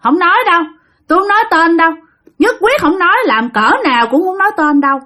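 high, stubborn spirit voice; suspicious ghost guarding its name; possessed grandmother refusing. Retold or imitated. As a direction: refuse flatly to answer, pitched high as the spirit. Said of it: "Nói vậy các bạn".

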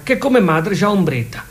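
normal talking voice

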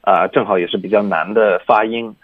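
normal talking voice

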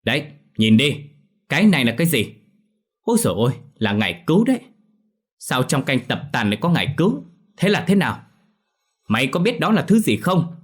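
high, loud, nagging accusation; sharp-tongued scolding. Acted. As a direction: scold the servant shrilly over the soup. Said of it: "chua ngoa mà lên tiếng".

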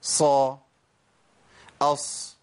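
صا then أص, each with a clear whistling s; إظهار الصفير. Shown as a demonstration. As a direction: make the ص whistle in صا and أص. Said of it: "لازم يبان الصفير". (صا وأص بصفير واضح)

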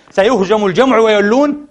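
ordinary delivery

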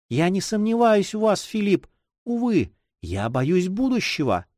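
put-on high, plaintive girlish voice; anxious young girl. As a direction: confess the fear gently, in a girl's voice. Girl confesses fear of the future.

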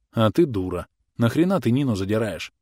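ordinary delivery

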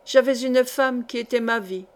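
usual speaking voice

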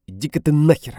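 woman flaring up at her ex in fury; furious outburst. A narrator's reading. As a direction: explode at him. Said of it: "взвивается она".

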